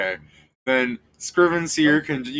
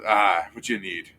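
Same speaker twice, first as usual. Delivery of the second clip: serious Dustin; 4 semitones lower than usual. Acted. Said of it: "He just gets kinda serious and goes".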